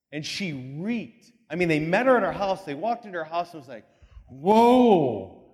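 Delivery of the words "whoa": loud disgusted whoa. Retold or imitated. Walking into a reeking house.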